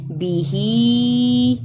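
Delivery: بیہی drawn out slowly, ہی lengthened; short madd. Shown as a demonstration. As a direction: stretch the ہی in بیہی. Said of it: "اس پہ چھوٹی مد ہے". (بیہی میں ہی کو کھینچ کر)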